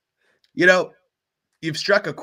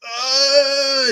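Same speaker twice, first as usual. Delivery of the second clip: high, loud, drawn-out "ohhh"; long eerie "ohh"; 12 semitones higher, 7 dB louder than usual. Acted. Eyeless navigator on the screen, long eerie wail. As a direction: hold one strange high wail. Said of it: "he goes".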